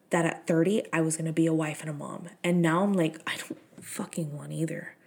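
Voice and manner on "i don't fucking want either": a blunt whispered confession. Retold or imitated. Telling listeners about not wanting kids, whispered. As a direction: drop to a whisper, half joking.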